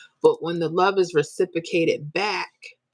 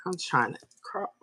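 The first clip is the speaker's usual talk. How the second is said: choked up, apologising as emotion breaks through; tearful; 4 semitones higher than usual. Real, not acted. Streamer thanking viewers for help, tearful. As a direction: fight back real tears while talking. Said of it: "I'm so sorry for crying".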